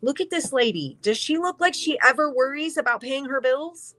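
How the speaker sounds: high-pitched, awed exclaiming; amazed disbelief. Real, not acted. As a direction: gush over the card's rich lady, pitch way up. Marvelling at the card lady.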